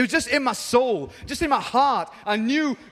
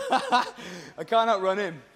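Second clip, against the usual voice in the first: slow line through laughter; laughing; much slower than usual. Real, not acted. Preacher joking with the crowd, laughing. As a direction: chuckle through the words. Laughing at own joke on robbing.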